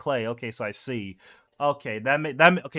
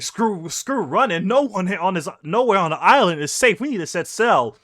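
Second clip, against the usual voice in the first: high, louder desperate plea; desperate pirates; 5 semitones higher, 6 dB louder than usual. Retold or imitated. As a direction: insist there's no escape by land. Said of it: "It's like".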